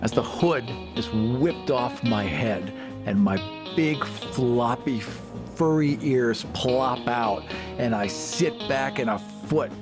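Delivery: normal talking voice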